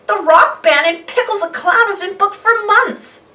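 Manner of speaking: high put-on boastful girl; spoiled girl. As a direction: show off the booking. Muffy boasting.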